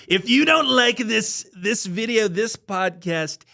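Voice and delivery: high, slow put-on Trump voice; hammed-up Trump bit; Trump impression. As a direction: drag it out like a mock Trump. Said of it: "I do the Trump stuff".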